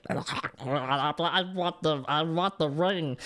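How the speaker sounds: slow, croaking creature imitation; hammed-up creature voice, very slow; Gollum. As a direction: draw the words out like a creature craving treasure. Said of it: "just sit like Golem".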